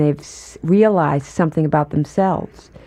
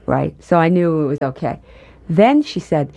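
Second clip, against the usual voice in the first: ordinary talk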